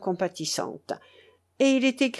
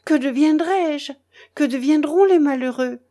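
raised, loud, anguished lament; tearful cry; 6 semitones higher, 5 dB louder than usual. A narrator's reading. Tearful curé begging his departing vicar, anguished cry. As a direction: plead aloud in distress. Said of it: "s'écria le curé les yeux pleins de larmes".

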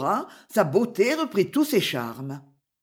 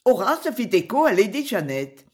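plain reading voice

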